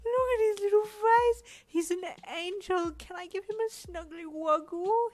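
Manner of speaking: put-on very high, loud cooing baby talk. A narrator's reading. Uncle cooing over the newborn, high baby talk. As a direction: coo at the baby. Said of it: "he said in a baby voice".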